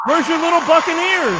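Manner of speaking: loud, very high mock question; reprised old lady. Comic repeating the old lady's setup, high and loud. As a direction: call it out high over the laughter.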